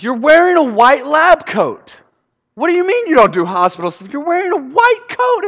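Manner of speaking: loud, high, incredulous outburst; frustrated yelling. Acted. Frustrated son to interior designer in a lab coat, incredulous yelling. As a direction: yell in exasperated disbelief. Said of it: "gets really frustrated".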